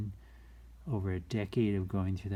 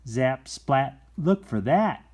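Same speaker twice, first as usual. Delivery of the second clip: loud playful punch; excited; 4 semitones higher, 8 dB louder, slower than usual. Acted. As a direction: slap the words out brightly. Beaver tail slap sound words.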